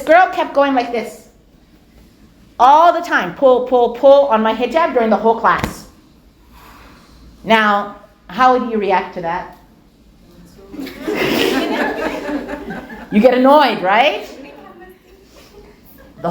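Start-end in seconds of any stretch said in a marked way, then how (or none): none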